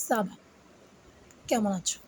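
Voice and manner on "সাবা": "সাবা" as short sa-ba; pronunciation demo. Shown as a demonstration. Teacher giving learners the French greeting, "সাবা" as sa-ba. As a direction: model "সাবা" with a crisp s. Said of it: "এইটার উচ্চারণ হচ্ছে".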